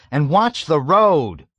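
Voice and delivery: loud, slow shout. A narrator's reading. Nately yelling at the reckless driver, loud. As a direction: bark the warning, drawn out. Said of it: "Nately shouted".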